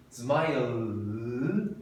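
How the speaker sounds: "Smile" with the final L held long; final L sound. Shown as a demonstration. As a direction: stretch the closing L of "Smile". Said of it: "O último som que eu falo é do L". ("Smile" com o L final prolongado)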